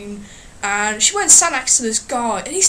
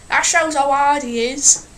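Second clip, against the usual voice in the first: ordinary talk, nothing marked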